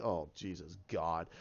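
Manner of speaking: low, soft, slow dismay; quiet groan. Retold or imitated. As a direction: mutter it in disbelief. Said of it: "I'm like".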